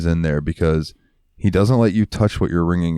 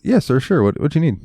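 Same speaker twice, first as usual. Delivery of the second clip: eager polite clerk; 8 semitones higher than usual. Retold or imitated. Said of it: "And I was like".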